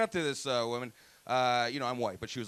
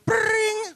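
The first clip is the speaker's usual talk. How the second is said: very high, loud trilled ring; imitated coin chime; 11 dB louder than usual. Comic punches old man and coins fly out, imitated chime. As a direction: shout a shrieking game-coin "bring".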